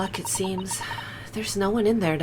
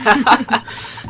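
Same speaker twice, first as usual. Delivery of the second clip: laughing; 6 semitones higher, 6 dB louder than usual. Real, not acted. Laughing at farting joke.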